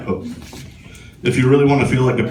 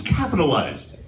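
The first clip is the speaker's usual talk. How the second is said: ordinary talk, nothing marked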